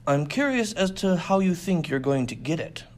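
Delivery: lightly menacing, coolly calm; a hint of danger. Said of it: "with an even more dangerous tone".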